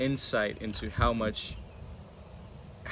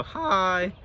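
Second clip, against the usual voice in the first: voice crack; 9 semitones higher, 4 dB louder than usual. Real, not acted. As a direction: voice cracking up high. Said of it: "That was a bad voice crack".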